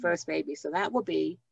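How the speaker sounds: ordinary talk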